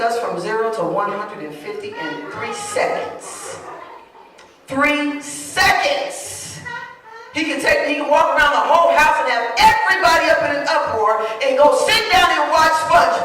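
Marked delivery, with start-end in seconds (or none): 4.7-6.6 SECONDS drawn out slowly, THREE stretched; "Three seconds" very slow and high for emphasis; emphatic exclamation. Acted. Exclaims over nephew's speed. 7.3-13.2 high, loud, driving sermon delivery; fired-up preacher, voice raised; full-force preaching. Acted.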